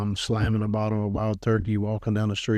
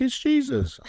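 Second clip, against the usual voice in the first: high-pitched, cheerful mimicry; happy believer imitation. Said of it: "he's all happy".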